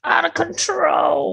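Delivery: high, drawn-out comic wail; mock frazzled wail. Podcast host on scheduling getting out of hand, mock wail. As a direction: wail it slowly in exaggerated despair.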